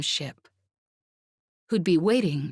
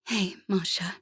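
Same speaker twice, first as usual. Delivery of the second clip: quiet, limp near-whisper greeting; barely bothering to answer her friend; listless; 2 semitones higher, 3 dB quieter than usual. Acted. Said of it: "said Jez listlessly".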